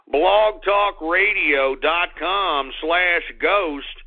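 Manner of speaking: slow, deliberate web address; slow address read. Real, not acted. Host reading out the show's web address slowly. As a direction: spell it out slowly for listeners. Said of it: "spells out web address".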